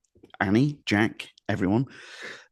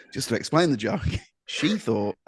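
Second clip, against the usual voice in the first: usual speaking voice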